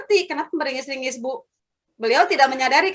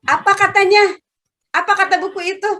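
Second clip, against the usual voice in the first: higher, pressing questions; quizzing a pupil; 6 semitones higher, 4 dB louder than usual. Retold or imitated. Teacher quizzes a pupil about the book, higher and pressing. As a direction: push the child for an answer.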